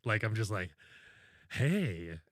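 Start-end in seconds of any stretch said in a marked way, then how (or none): 1.5-2.2 dopey stoned automated voice; high phone menu voice. Retold or imitated. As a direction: sound high as a kite reading a menu. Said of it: "it's like I'm high on something".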